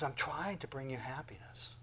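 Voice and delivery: soft, unvoiced whisper as God. God speaking to his people, whispered. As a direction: let God whisper it tenderly. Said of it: "He goes".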